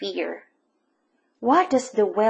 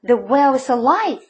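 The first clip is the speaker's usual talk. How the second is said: breathless, louder rush; excited gasp; 4 semitones higher, 7 dB louder than usual. A narrator's reading. Boy telling Papa the whale lives. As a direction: blurt it out in wonder. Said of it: "I gasped".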